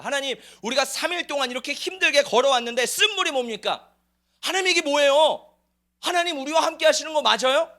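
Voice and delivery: acted indignant complaining; grumbling complaint. Israelites complaining to God about bitter water, indignant whine.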